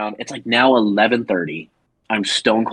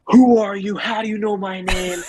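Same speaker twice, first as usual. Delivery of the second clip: mock freaking out; 10 semitones higher than usual. Drunk Tony panics at strangers, high freaked-out voice. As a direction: play the drunk guy losing it. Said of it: "he just freaks out".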